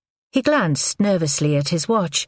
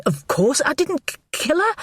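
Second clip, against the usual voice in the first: higher, a trace of irritation; irritated; 11 semitones higher than usual. A narrator's reading. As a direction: sound a little irritated. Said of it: "a trace of irritation in his voice".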